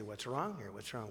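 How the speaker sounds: low, soft worried question among the tables; quietly checking on a troubled room; hushed concern. Retold or imitated. Pastor softly asks the silent congregation, a hushed worried question.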